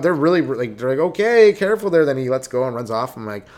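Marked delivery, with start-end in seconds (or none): 1.0-2.0 higher, louder parent voice; raised singled-out warning to a kid; parent's mild warning. Retold or imitated. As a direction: imitate a parent cautioning a child.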